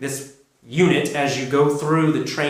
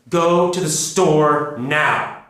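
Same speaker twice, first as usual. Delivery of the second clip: slow, firm order with NOW dropping down; strong commanding fall; 3 dB louder, much slower than usual. Shown as a demonstration. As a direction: drop the pitch at the end on "now", commanding. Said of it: "goes down at the end to make it sound strong and commanding".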